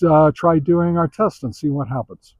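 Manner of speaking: ordinary delivery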